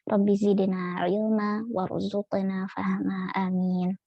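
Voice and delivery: measured Arabic invocation opening a study circle; opening prayer. Acted. Teacher opening the study session with prayer, measured intoning. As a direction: intone the opening prayer slowly before the lesson.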